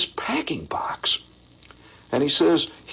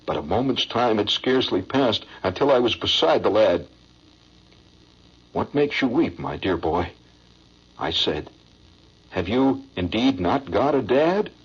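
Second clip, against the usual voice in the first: poem recitation; 7 semitones lower than usual. Acted. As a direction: recite it like a heart-tugging stage ballad.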